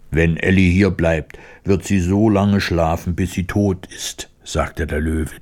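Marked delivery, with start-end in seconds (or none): none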